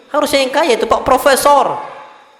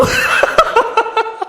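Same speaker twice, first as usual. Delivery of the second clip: real high-pitched laugh; helpless, high giggling at his own story; bursting into laughter; 12 semitones higher than usual. Real, not acted. Preacher cracks up at wife's goat-price reaction, laughing.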